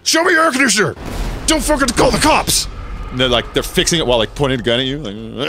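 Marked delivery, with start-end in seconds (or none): 0.0-2.7 mock terrorist shout. Repairman terrorist yelling at the homeowner, hammed-up shout. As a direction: bark it like a thug holding you at gunpoint.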